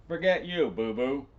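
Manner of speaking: sass the giant right back; defiant taunt. Acted. Jenny taunting the giant, loud and cheeky.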